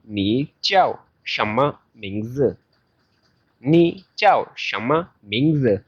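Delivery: "Ni jiao shenme mingzi?" slow, each tone clearly shaped; slow Mandarin model. Shown as a demonstration. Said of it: "This sentence is pronounced like".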